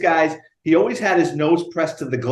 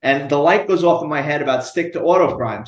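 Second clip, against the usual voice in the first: usual speaking voice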